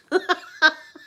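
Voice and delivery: high, loud burst of laughter; real laugh. Real, not acted. Joking about being old, high hearty laugh.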